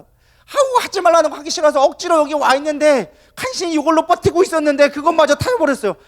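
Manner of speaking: high, loud exasperated outburst; angry Jonah. Acted. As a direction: fume loudly about being wronged. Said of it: "요나가 화가 난 거야".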